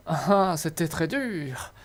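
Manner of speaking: mock-exhausted, drawn-out complaint; faux gémissement comique. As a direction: groan it out slowly, hammed-up and jokey. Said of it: "Non, plus sérieusement". (soupir exagéré, pour rire)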